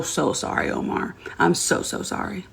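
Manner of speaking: soft, whispered, sorrowful sorry; whispered apology. Real, not acted. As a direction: hush down and apologize to him tenderly. Apologizing to the denied referee.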